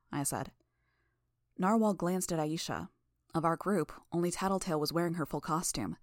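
ordinary delivery, nothing marked

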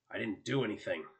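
mild snarl, lightly voiced man; snarled denial. A narrator's reading. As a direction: deny it with a touch of a snarl. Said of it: "the man snarled".

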